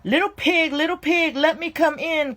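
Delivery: high, coaxing shark voice calling at the door; anxious shark calling. Acted. Hungry shark begging the pigs to open, high and eager. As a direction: call up high, eager to get in. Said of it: "called the hot, hungry magic shark anxiously".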